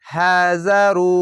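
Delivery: হাজারু slow, long vowel drawn out; Quranic recitation. Shown as a demonstration. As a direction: recite হাজারু slowly, stretched. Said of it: "এক আলিফ টেনে পড়বেন".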